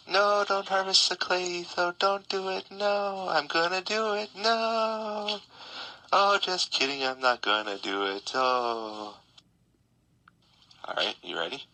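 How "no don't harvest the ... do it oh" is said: high, pleading mock fan; pleading viewer voice. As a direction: beg him not to, squeaky. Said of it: "And you're gonna be like".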